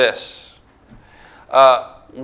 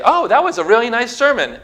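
high-pitched mimicked compliment; mock churchgoer praise; 8 semitones higher than usual. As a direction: gush politely like a churchgoer, much higher.

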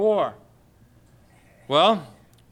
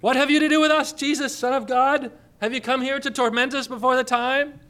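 much higher, shrieking demon plea; crying demons; 6 semitones higher than usual. Retold or imitated. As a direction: cry out like cornered demons. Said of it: "the demons cried out".

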